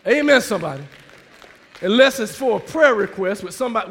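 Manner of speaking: ordinary talk, nothing marked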